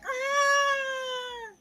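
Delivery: very high, thin scream; mock scream. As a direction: let out a wild high yelp. Son runs out screaming.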